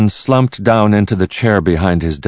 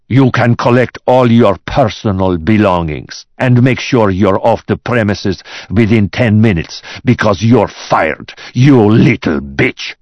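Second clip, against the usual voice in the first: raised, forceful dismissal; furious boss; 5 semitones higher, 5 dB louder than usual. Acted. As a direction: fire the secretary with open anger, slowing down. Boss furiously fires his secretary.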